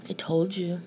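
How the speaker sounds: ordinary delivery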